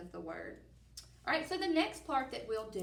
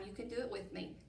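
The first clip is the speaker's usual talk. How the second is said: ordinary talk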